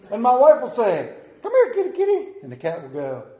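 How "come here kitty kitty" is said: very high, loud kitty-calling voice; imitating wife's cat call. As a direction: mimic a wife coaxing the cat in falsetto.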